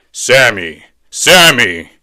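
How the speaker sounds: loud, booming deep double call. Acted. Deep voice calls the child twice, deep and loud. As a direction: boom the name out twice, deep. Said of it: "hear this deep voice say my name".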